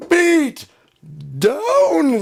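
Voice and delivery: high, loud triumphant "beat down" shout; mock victory bellow, words stretched; gloating yell. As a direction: crow it out like you just won big. Friend gloating after winning.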